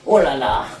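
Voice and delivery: low, breathy mock French; French accent. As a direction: sigh it like a Parisienne. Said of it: "See, I'm French there".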